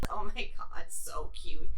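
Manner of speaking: breathless whispered gush over something cute. Real, not acted. Reviewer gushing over the adorable shark head, breathless whisper. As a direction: whisper it like you're melting at a puppy.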